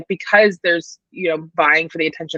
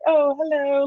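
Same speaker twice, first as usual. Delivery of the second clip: put-on British accent; 8 semitones higher, slower than usual. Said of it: "when I was in London".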